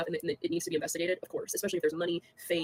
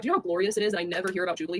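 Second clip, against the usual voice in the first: ordinary talk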